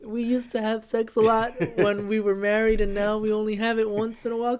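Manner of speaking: put-on high, loud, pleading kid voice; awkward boy king begging the priest; whiny boy king. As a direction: ham up a timid boy asking a priest for help. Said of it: "he's like".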